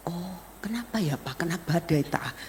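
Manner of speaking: very soft, tentative question; hushed questioning. Retold or imitated. Family asking father about his loss, hushed. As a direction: ask it gently, almost under the breath.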